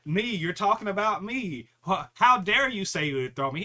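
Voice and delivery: loud, high offended squawking; mock outrage. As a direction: imitate someone indignant, pitched high and loud. Mimicking offended people's outrage.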